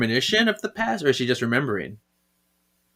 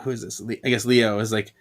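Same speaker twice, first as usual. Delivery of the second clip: usual speaking voice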